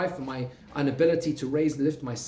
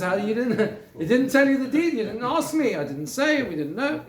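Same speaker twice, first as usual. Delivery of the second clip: higher, laughing through a sly retort; laughing mock excuse; 8 semitones higher, 4 dB louder than usual. Teacher voicing Lavan brushing off Yaakov, laughing. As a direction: chuckle it off like a swindler caught out.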